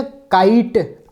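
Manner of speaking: काइट drawn out slowly with a clear long i; kite, slow and clear; slow kite demo. Shown as a demonstration. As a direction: stretch काइट so the long vowel is heard. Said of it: "आई की आवाज़ जो पहले नहीं आ रही थी".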